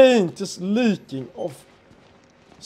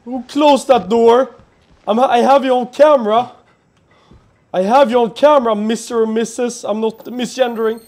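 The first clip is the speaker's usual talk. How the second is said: loud, high defensive shouting; nervous shouting; 6 semitones higher, 8 dB louder than usual. Real, not acted. Scared gamer shouting loud and high at the unseen door-closer. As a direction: shout bravely while scared.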